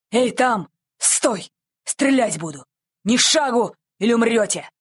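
stern, threatening challenge; menacing commands. A narrator's reading. Patrol halting the thieves at gunpoint, menacingly. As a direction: order them to halt with menace. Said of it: "грозные голоса".

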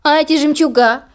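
high, pampered complaint; spoiled sister. Acted. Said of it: "не отставала от сестры Анастасия".

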